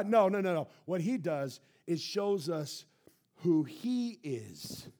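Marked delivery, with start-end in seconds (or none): none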